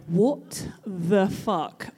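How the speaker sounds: very slow, spaced-out words; drawn-out, acted bewilderment; stunned disbelief. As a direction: mimic dumbfounded onlookers, one word at a time. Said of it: "with that, like".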